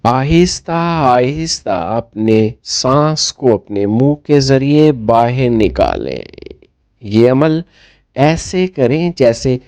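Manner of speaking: guided meditation. Acted. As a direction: ease the listener into stillness, phrase by phrase.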